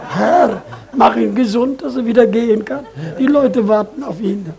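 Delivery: imitating a praying brother, loud and pleading, with a laugh; retold brother praying loudly for the sick pastor; fervent loud prayer.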